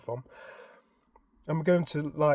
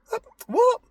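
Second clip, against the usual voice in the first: high, loud disbelieving what; stunned what; 7 dB louder than usual. Retold or imitated. Husband hearing wife's secret, high stunned what. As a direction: reel in shock. Thinking in shock.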